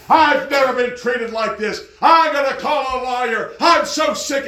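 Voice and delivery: loud, high-pitched angry rant; ranting and raving. Retold or imitated. Preacher mimics an angry patient in a doctor's office, ranting. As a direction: imitate an outraged lady raising her voice. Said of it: "ranting and raving".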